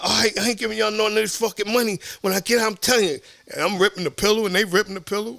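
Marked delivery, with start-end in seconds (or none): 0.0-3.2 mock cursing. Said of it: "So I'm cursing them out".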